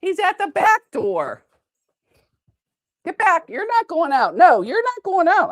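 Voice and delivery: high, sing-song scolding of the dogs; high-pitched scolding. Real, not acted.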